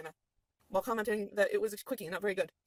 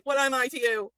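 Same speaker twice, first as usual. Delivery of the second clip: louder, higher, pressing the wolf for an answer; demanding loud question; 5 semitones higher, 13 dB louder than usual. Acted. Kriv asks Best Boy what he is to him, loud and pleading. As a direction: make him say what you mean to him.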